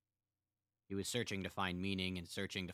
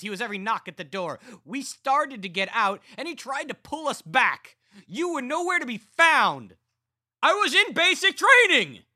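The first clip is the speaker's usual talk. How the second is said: high, loud shouted rant; furious shouting; 18 dB louder than usual. A narrator's reading. Carl shouting back at his brother, furious. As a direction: shout him down, full of rage.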